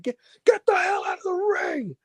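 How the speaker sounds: shrieking high mock yell of get out; mock furious shout. Wrestler kicking her out of the ring, high mock shout. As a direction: screech it like an enraged boss. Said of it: "and he said".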